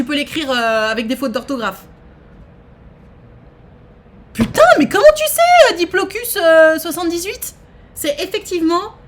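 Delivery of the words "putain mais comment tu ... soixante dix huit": cri bien plus aigu et fort; n'en revient pas, s'exclame; stupéfaction criée. Real, not acted. Stupéfaite par la réponse. (crie sa stupeur devant la bonne réponse de Diplocus)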